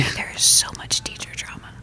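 conspiratorial gossip whisper; giggly prank whisper. Pretend. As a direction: hush it like a secret. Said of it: "Stop whispering into the mic".

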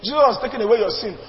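ordinary talk

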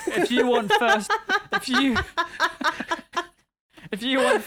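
slow, broken by giggles; helpless laughing. Real, not acted. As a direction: try to get it out while cracking up. Laughing too much to finish.

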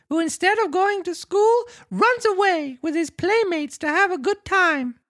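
frantic confession in a very high puppet voice; Pinocchio, crying out. Acted. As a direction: take it all back as a scared, squeaky marionette. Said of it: "He cried out".

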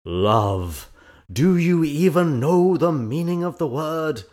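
loud, high, hammy stage outrage; grand tragic wailing. Acted. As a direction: declaim it like a melodrama villain. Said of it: "and then a few notes deeper".

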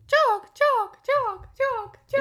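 high, loud falling bird notes; put-on nightingale call, far above normal pitch; pretty bird version. Host demonstrates the nightingale's song, high mock birdsong. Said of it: "is that the pretty version you're imagining".

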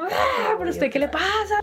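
imitating angry brother. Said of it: "súper bravo".

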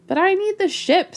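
high-pitched pleading protest. A narrator's reading. Snake objects to losing the ship, protesting. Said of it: "Snake protested".

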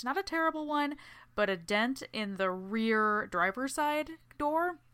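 ordinary delivery

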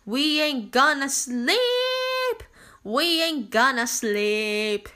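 slow, drawn-out defiant chant; stubborn body voice. Retold or imitated. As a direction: drag it out like a body refusing to sleep. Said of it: "said my body".

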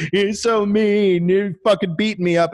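exaggerated tearful imitation; mock crying. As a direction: blubber it like a picked-on kid. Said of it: "I'm crying".

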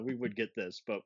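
ordinary talk, nothing marked

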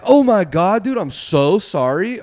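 high, loud, stretched-out apology; mock alarmed apology. Storyteller to man with bat, mock alarmed apology. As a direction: apologize in a fluster.

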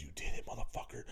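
hushed, breathy you did it; whispered accusation. Retold or imitated. Jay whispering to his friend after the wreck. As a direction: whisper it under your breath.

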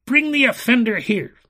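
high, loud tyrant's order; loud command. A narrator's reading. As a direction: order the guards at full voice. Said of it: "commanded the enchanter".

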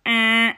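loud, high horn blast; air horn imitation. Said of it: "he'd blow the air horn".

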